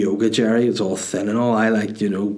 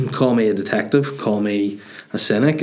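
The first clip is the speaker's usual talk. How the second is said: usual speaking voice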